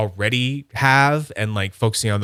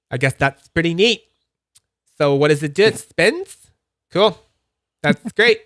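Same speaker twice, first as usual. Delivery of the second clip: mock polite interest; 6 semitones higher, 5 dB louder than usual. Polite, unimpressed friend.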